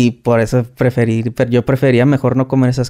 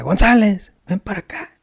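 imitated shout; 8 semitones higher than usual. Sergeant calls the soldier over, mock shout. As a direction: mimic a sergeant yelling someone over. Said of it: "Y me grita".

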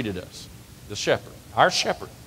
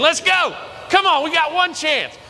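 much higher and louder, rallying the crowd; excited high calling out; 4 dB louder than usual. Real, not acted. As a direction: fire them up to bleat together. Hyping crowd for sheep imitation.

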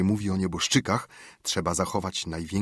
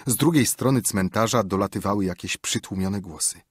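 ordinary delivery

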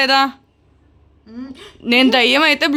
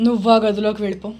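lower, brisk instruction; quick order; 3 semitones lower, 4 dB quieter, faster than usual. Pretend. Ghost sends person to other room, quick and low-key.

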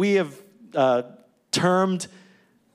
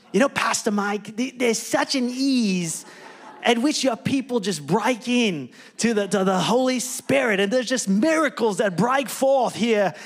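put-on New Zealand accent; 3 semitones higher than usual. Pastor quoting the New Zealand preacher in a mock New Zealand accent. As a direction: try a Kiwi New Zealand accent that slips toward Australian. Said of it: "I would try to do a New Zealand accent".

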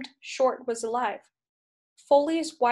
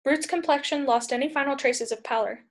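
usual speaking voice

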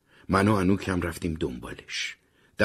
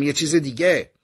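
loud call for attention, raised high; a boy yelling one more thing; shouting after him; 9 semitones higher, 7 dB louder than usual. Acted. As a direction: shout it as he's being dragged off. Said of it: "داد زدم".

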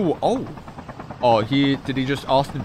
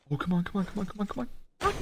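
tense quick urging; 8 semitones higher, 6 dB quieter, faster than usual. Real, not acted.

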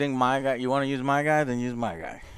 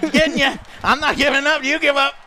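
higher, louder taunting yell; yelling trash talk; 11 semitones higher, 6 dB louder than usual. Real, not acted. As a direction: shout it while mashing buttons. Button-mashing trash talk.

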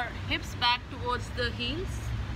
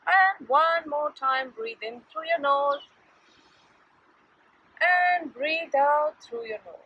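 slow, calm breathing cues; guided breathing; 3 semitones higher, 5 dB louder, slower than usual. Acted. Yoga teacher guiding kids' breaths, slow and calm. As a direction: pace the breaths gently for the class.

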